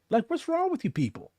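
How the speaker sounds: high, incredulous question; exasperated. Real, not acted. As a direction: pitch way up in disbelief. Exasperated rant at commenters.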